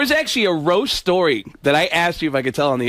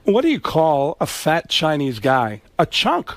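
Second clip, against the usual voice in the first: slow, halting celebrity impression; oddly paced roast comic with a sudden exclamation; Christopher Walken; slower than usual. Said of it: "What does Christopher Walken sound like".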